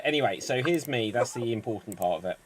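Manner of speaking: ordinary delivery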